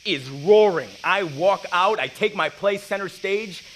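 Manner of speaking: rising excitement. Acted. Stepping onto the biggest stage of his life, thrilled. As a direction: ride the roar of the crowd.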